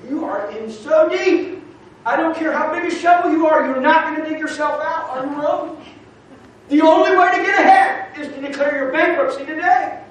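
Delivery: fiery sermon climax; preaching at full force. Real, not acted. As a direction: hammer it home to the congregation.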